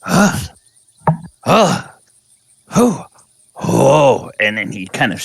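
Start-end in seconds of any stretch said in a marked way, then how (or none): none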